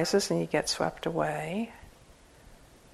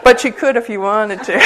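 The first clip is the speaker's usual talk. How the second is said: laughing; 12 semitones higher, 6 dB louder than usual. Real, not acted. Teasing joke about prostrations.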